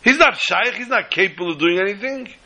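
mock him as crazy; incredulous scoffing. Businessman scoffing at skinny helper.

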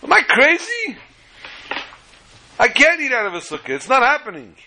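high-pitched, incredulous protest; exasperated outburst. Acted. Guest breaking off his blessing outside the sukkah, exasperated. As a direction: burst out high, then ease down in refusal. Guest balks halfway through Kiddush.

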